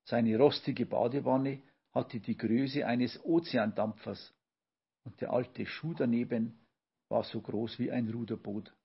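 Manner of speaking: usual speaking voice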